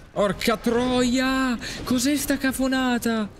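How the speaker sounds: high, drawn-out indignant curse; slow, high-pitched outrage; outraged cry. Real, not acted. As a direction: stretch the curse out in disbelief. Outraged at enemy attack.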